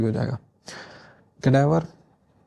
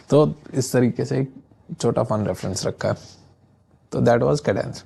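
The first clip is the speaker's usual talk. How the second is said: usual speaking voice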